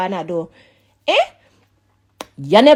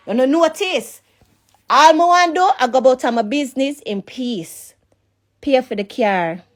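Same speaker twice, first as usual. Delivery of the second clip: ordinary delivery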